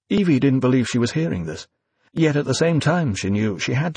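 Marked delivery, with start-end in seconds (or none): none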